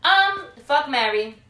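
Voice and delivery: shouting out the choice, high and loud; loud playful game call; loud announcement. Real, not acted.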